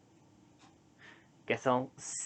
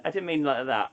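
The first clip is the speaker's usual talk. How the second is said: normal talking voice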